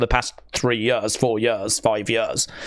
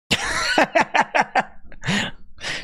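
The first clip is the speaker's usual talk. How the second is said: airy laugh up high; high breathy giggle; 11 semitones higher than usual. Real, not acted. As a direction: giggle in delight. Laughing at koala.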